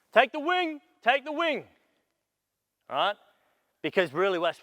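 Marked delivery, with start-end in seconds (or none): 0.1-1.7 high, raised court call; loud captain's call. Shown as a demonstration. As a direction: call it out to teammates. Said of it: "Captain's gotta be the loudest voice".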